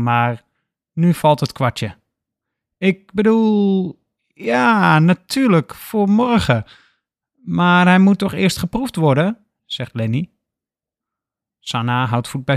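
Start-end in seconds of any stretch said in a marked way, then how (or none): 2.8-9.5 higher, slow then coaxing; flustered cover-up. A narrator's reading. Lenny covering his mistake to Sanna, higher flustered wheedling. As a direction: backpedal then wheedle for a taste.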